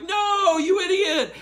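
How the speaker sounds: exasperated yell; exasperated high shout. Real, not acted. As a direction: yell at the screen in frustration. Exasperated at the character.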